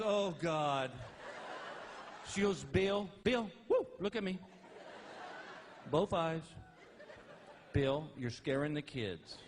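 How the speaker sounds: a hint of an alarmed wife; wife snapping fingers at him, a touch of alarm; lightly voiced wife. Wife trying to rouse stoned husband, lightly voiced worry. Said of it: "my wife goes".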